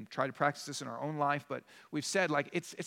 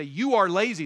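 loud, emphatic mock rebuke; mock blunt accusation; 3 semitones higher, 10 dB louder than usual. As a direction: throw the label at them loudly.

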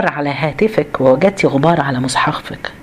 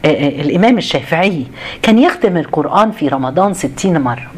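ordinary talk

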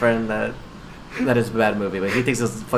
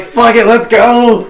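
hyped action hero; 10 semitones higher, 10 dB louder, much slower than usual. Retold or imitated. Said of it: "Is like".

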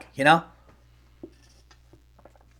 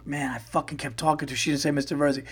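quieter, quicker aside to a buddy; 8 dB quieter, faster than usual. Retold or imitated. Comic retelling his complaint to Joe, softer aside. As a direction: mutter it quickly to the friend beside you.

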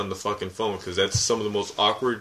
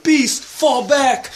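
high, slow shouted battle command; cartoon hero shout; 14 semitones higher, 6 dB louder, much slower than usual. Quoting Morph cartoon line.

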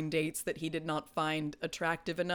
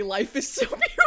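quoting through laughter; 6 semitones higher, 7 dB louder, faster than usual. Retold or imitated. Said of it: "you say".

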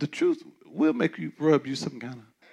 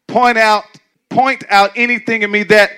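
leading scripture; 8 semitones higher, 12 dB louder than usual. Shown as a demonstration. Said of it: "leading the reading again".

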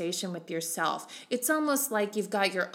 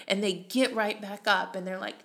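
ordinary delivery

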